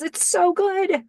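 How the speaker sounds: high, drawn-out gush; excited. Retold or imitated. Karen gushing to Graham about the scene, high and excited. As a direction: gush slowly in a high imitation of her delight. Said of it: "She's so excited".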